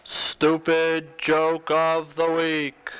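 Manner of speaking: very slow, drawn-out announcer voice; segment announcer. Pretend. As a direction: stretch out each word like a show title.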